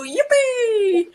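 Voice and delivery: high, loud whoop that tips into a laugh; joyful yippee. Shown as a demonstration. Leader showing the victory cheer, high laughing whoop. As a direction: throw the arms up and whoop. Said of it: "just go".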